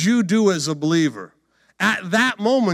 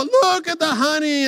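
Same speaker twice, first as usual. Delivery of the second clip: hammed-up high wonder; gleeful discovery; 11 semitones higher, 5 dB louder than usual. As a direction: gush over the find. Acting Samson seeing the honey.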